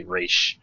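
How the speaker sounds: "Ra's" said as "raysh"; Raysh pronunciation. Shown as a demonstration. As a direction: say "Ra's" with a long AY, ending in SH. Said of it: "went out of their way to say".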